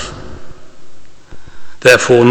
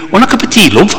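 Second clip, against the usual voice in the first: higher-pitched Bristolian tea offer; Bristolian accent; 8 semitones higher, much faster than usual. Retold or imitated. Greeting his wife at work in Bristol, imitated Bristolian accent. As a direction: play a friendly Bristolian colleague offering tea. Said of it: "trying to do the Bristolian accent".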